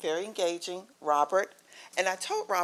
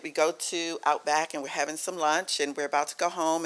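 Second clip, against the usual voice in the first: usual speaking voice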